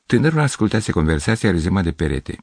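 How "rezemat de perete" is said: ordinary delivery, nothing marked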